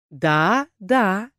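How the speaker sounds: drawn-out villain agreement; snide drawl. A narrator's reading. Rebel leader confirming the accusation, snidely. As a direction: stretch it out with sly malice. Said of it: "ехидно протянул лидер".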